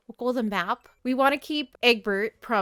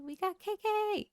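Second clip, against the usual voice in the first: thrilled; 10 semitones higher, 4 dB quieter than usual. Real, not acted. K K arrives at her island.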